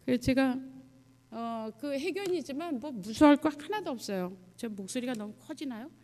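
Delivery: normal talking voice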